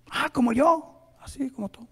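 a hint of a small daughter; child's voice. Father voicing his little girl, lightly high.

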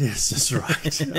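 ordinary delivery

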